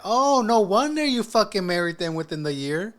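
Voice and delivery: loud, high exclamation of mock realization; high-pitched, incredulous outburst. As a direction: blurt it out loud like it all suddenly adds up.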